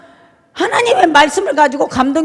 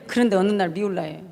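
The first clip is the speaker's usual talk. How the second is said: ordinary talk, nothing marked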